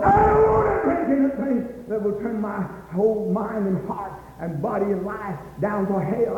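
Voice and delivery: ordinary delivery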